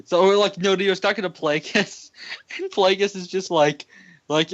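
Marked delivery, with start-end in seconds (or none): none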